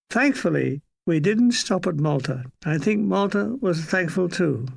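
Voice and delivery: usual speaking voice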